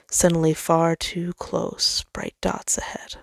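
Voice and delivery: plain reading voice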